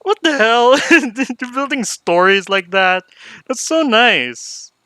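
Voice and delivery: high, loud delighted shriek then slow gushing; surprised squeal. Real, not acted. Streamer reacting to the game's story, surprised and delighted. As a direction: caught off guard, then melting over the scene.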